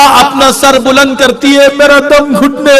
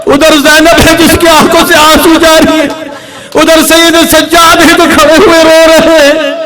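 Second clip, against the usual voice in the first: impassioned lament; 5 semitones higher than usual. Acted. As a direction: pour grief into every line. Mourning climax of the majlis.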